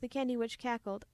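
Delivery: ordinary delivery, nothing marked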